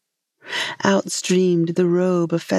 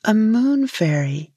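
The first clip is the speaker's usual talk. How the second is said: "moon" lifted high and stretched; correcting stress on moon; 4 semitones higher than usual. A narrator's reading. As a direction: correct him by raising the pitch on "moon". Fairy corrects the fisherman.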